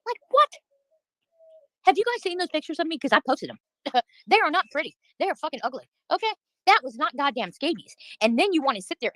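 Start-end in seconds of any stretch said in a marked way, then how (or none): 0.1-3.0 incredulous. Real, not acted. Incredulous at accusation.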